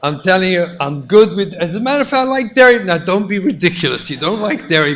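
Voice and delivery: loud, high loving insistence; eager insisting. Acted. Husband and wife loudly insisting on each other's choice. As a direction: outdo each other in giving way.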